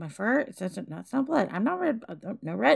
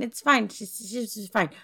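ordinary talk

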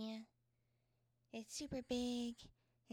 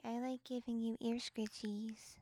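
whisper; 6 dB louder than usual. Real, not acted. Ear scritchies ASMR whisper.

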